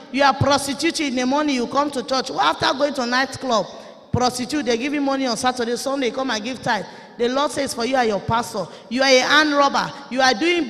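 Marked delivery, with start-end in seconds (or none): none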